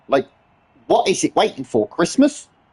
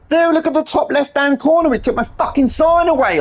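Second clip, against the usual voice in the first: outraged disbelief; 8 semitones higher than usual. Real, not acted. Outraged at the game.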